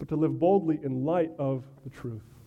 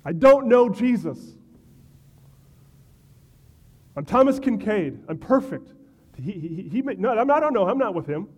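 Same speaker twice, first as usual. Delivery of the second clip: higher and louder, stammering and flustered; a caught liar scrambling, higher and louder; panicked denial; 5 semitones higher, 6 dB louder than usual. Acted. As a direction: babble the lie in a comic panic. Comic modern panicked denial.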